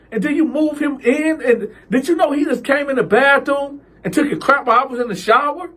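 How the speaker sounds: high heated argument; upset. Retold or imitated. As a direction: argue high and fast. Cal arguing with mom.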